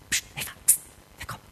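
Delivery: urgent, hushed whisper; furtive whisper. Acted. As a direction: whisper secretly to a partner in hiding. Adam lockt Eva ins Versteck. (heimlich flüsternd)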